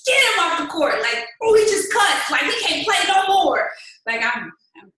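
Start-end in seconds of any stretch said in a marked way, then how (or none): 0.1-3.7 much higher and louder, slow insistence; loud mock outrage at husband; emphatic retort. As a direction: fire back at him. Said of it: "I'm like".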